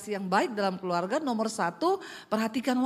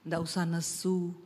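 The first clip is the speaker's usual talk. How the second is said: low, soft Javanese husband; lightly voiced husband, subdued and low; husband's reply; 7 semitones lower, 5 dB quieter than usual. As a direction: answer low and quiet. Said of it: "Dia bilang".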